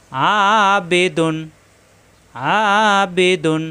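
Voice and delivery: "আবেদুন" slowly with long opening "আ"; one-alif madd. Shown as a demonstration. Teacher drills madd word, slow drawn-out "আবেদুন". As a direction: hold the first vowel of "আবেদুন". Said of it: "এক আলিফ টান".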